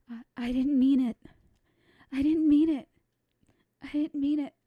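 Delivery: loud, upset, repeating it; distraught loud apology. Acted. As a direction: plead over and over, upset. Distraught girl repeats apology loudly.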